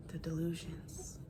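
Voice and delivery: soft breathy whisper. Shown as a demonstration. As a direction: whisper it in disbelief. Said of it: "repeated, then whispered".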